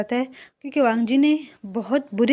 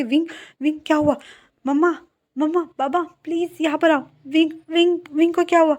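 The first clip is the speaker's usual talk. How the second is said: higher and louder, calling for help in alarm; panicked calling out; 5 semitones higher, 3 dB louder than usual. Acted. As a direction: cry out to mama and baba in a panic. Yanli sees Wing collapse. (ऊँची, तेज़ घबराई हुई पुकार)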